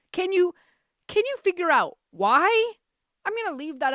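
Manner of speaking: high, very loud taunting question; theatrically loud, high-pitched quizzing; mock challenge. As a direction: call it out, swooping up at the end.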